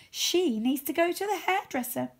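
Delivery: high, mocking poodle voice; a touch of giggling snobbery; snooty poodle. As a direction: sneer like a spoiled poodle. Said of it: "giggling and whispering".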